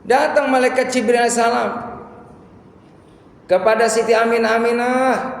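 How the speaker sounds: high, loud, sustained proclamation; dramatic sermon call. Acted. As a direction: announce the angel's arrival and call out the name. Dramatic narration of angel calling Aminah.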